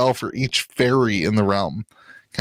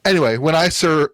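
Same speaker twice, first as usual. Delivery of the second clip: ordinary delivery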